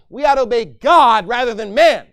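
loud, high, defiant proclamation; bold declaration. Acted. Peter defying the high priests, loud and bold.

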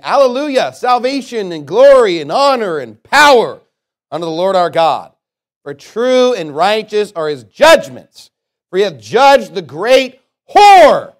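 loud, slow scripture proclamation; great voice. A narrator's reading. Preacher reading the voice of heaven's people, great voice. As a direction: proclaim it with rising force, leaning on the key words. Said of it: "I heard a great voice of much people".

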